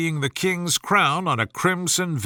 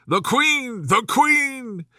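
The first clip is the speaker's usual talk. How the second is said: much higher, alarmed cry; calling out; 9 semitones higher, slower than usual. A narrator's reading. Five warning the others the Queen comes, calling out. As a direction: raise the alarm across the garden. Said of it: "called out".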